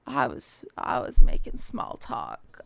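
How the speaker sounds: breathy whisper, trailing off; sheepish murmured excuse; whispered defense. Real, not acted. Flustered defense.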